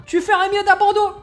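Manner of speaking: high, loud heated warning; fired up. Acted. Fake referee urging Cerise to give up, loud and heated. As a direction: get carried away telling her to quit. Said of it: "qui s'enflamme".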